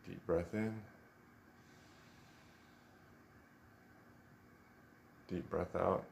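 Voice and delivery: soft, unhurried meditation voice with long pauses; guided meditation. Real, not acted. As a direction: gently guide them into stillness, leaving space after each instruction.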